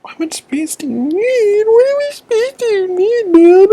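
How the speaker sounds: high, pleading exasperation at the tablet; begging a stubborn gadget for space; exasperated. Real, not acted. Arguing with her full iPad.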